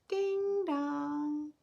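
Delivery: sung two-note doorbell, high; doorbell chime. Acted. Story reader to children, singing the doorbell. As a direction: chime it like a bell.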